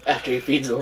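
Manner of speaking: normal talking voice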